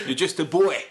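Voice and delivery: loud, high taunt; mock hard man. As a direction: taunt like a comic bruiser. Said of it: "That's how he talks".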